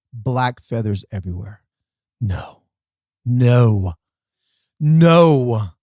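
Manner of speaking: retold horror, each "no" growing stronger; mock dread. Retells discovering his dead chickens.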